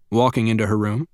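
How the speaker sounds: normal talking voice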